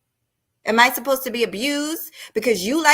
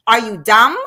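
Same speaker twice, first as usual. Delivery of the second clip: loud; 7 dB louder than usual. Real, not acted. Ranting at critic.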